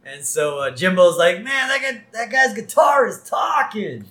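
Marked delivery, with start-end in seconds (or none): 1.4-4.1 put-on amazed teen. Host voices a kid at the concert, amazed. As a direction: marvel at it, stretching the end.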